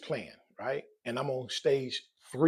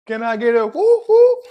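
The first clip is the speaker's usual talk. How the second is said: high, loud whoop for the crowd; cheering call; 7 semitones higher, 12 dB louder than usual. Real, not acted. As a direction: rise into a celebratory whoop. Calls chat for a cheer.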